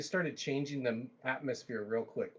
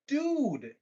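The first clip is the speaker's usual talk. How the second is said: a much higher, louder, fed-up "Dude"; exasperated outburst; 12 semitones higher, 4 dB louder than usual. Retold or imitated. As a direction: cut him off in disbelief. Heated argument with carpet cleaner.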